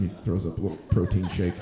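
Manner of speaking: ordinary delivery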